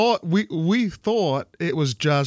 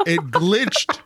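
rising higher, breaking into a laugh; incredulous outrage; 6 semitones higher, slower than usual. Real, not acted. Gamer telling friends about his glitched revive, incredulous outrage. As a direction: protest it in disbelief, half laughing.

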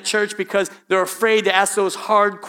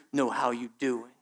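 earnest question; 8 semitones lower, 9 dB quieter than usual. Retold or imitated. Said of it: "she got real big-eyed".

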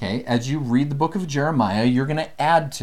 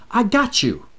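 casual reassurance; 10 semitones higher, 3 dB louder than usual. Retold or imitated. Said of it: "in essence they say".

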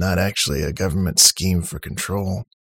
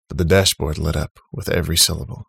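ordinary delivery

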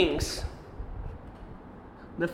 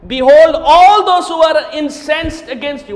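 much louder, higher; booming proclamation; 5 semitones higher, 9 dB louder than usual. Acted. Preacher proclaiming the verse over the enemy. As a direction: declare it from the pulpit.

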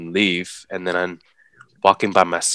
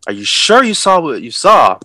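retold disbelief; 7 semitones higher, 6 dB louder than usual. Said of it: "I'm like".

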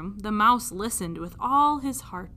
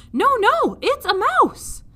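put-on much higher, loud childlike voice; insistent princess; 13 semitones higher, 9 dB louder than usual. Princess contradicting her father, childlike insistence. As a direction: insist like a little girl. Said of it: "princess insists".

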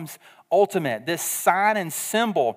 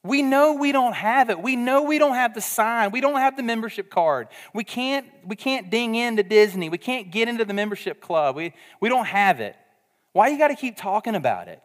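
higher pitched, whiny complaining voice; a hammed-up gripe from the left-out crowd; exasperated Gentiles; 5 semitones higher than usual. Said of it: "They'd have been like".

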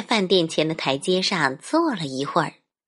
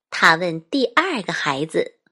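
ordinary delivery

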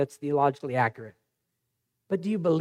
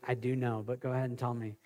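much softer, weary patience; tired dad reply; 6 semitones lower, 12 dB quieter than usual. Retold or imitated. Dad answering his excited son at dinner, softly weary. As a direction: humor the kid, worn out. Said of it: "I'm like".

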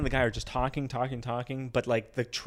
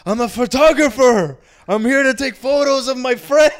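high, very loud, over-the-top declaration; mock proclamation; 11 semitones higher, 12 dB louder than usual. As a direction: shout the cover story like a brazen drunk friend. Friend proclaims his cover story.

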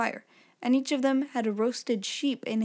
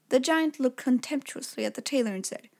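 ordinary delivery

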